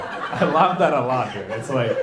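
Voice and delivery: ordinary talk, nothing marked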